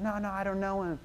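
lightly acted protest of innocence; hurried denial. Peter denies knowing Jesus.

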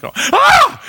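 sudden high yelp of fright; a sharp, very high scream at the mirror; startled shriek. Retold or imitated. Man seeing his bald spot, shrieking in fright.

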